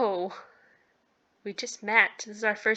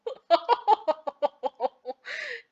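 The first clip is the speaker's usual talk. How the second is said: long, high laugh; laughter; 13 semitones higher than usual. Real, not acted. Player tickled by his burn in hell line, high laugh.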